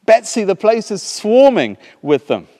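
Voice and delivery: high, loud distressed plea; alarmed. Acted. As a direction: cry out in alarm to your sister. Corrie alarmed to her sister.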